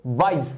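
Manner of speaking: Wise said as one unbroken sound; one-syllable demo. Shown as a demonstration. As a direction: say wise in a single go. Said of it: "एक बार में".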